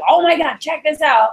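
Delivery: high, thrilled burst; excited manager giving a deal; fired up. Shown as a demonstration. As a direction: pitch it up as if bursting with news. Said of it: "you're fired up every time".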